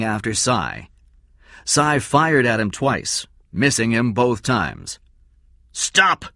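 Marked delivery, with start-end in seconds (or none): 5.7-6.3 high sharp command; shouted. Acted. Man shouts at fleeing Cy, sharp yell. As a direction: yell it after him. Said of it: "the man shouted".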